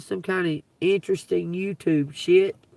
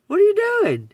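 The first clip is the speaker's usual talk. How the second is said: much higher and louder, calling out the question in disbelief; loud incredulous call; 14 semitones higher, 7 dB louder, faster than usual. Real, not acted. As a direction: call it out at them, fully fed up. Repeats the question at them.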